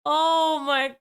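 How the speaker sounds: helpless laughter, slow; laughing hard. Real, not acted. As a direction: laugh until it hurts. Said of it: "I'm in pain".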